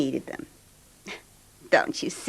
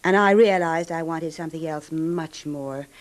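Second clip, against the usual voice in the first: ordinary talk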